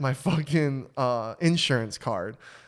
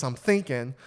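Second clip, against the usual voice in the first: ordinary delivery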